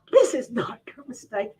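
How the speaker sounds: whispered aside; confiding whisper. Retold or imitated. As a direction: whisper it like a secret.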